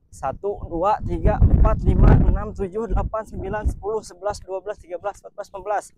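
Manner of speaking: jolting voice, counting aloud while jogging; counting while running. Real, not acted. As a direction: count out loud as you run, breath bouncing. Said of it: "ini guncang banget ya kita bawa larinya".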